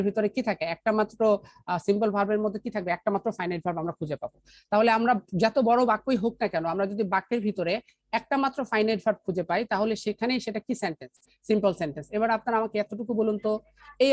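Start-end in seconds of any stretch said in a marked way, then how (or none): none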